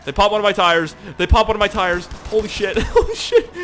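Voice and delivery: loud, high laughing disbelief; real worked-up yelling; excited alarm. Real, not acted. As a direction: shout in amused panic. Tires shot out in game.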